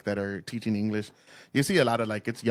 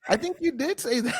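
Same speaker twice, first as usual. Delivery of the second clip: mock retort; 12 semitones higher than usual. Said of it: "And I was like".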